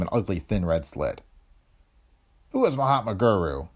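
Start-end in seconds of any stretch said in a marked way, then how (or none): 2.5-3.7 guarded, suddenly tense question; tense husband. Acted. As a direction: play dumb but rattled. Pete startled by question.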